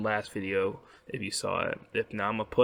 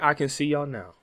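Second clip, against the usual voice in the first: ordinary delivery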